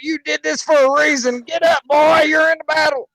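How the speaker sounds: yelling like a drill sergeant; drill sergeant shout. Retold or imitated. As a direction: bark orders at a downed soldier. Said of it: "I'm like".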